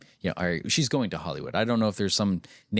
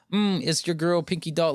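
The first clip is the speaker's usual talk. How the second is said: lightly imitated sing-song streamer; a hint of a cutesy e-girl intro; NPC streamer voice; 7 semitones higher than usual. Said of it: "She starts off every single video like".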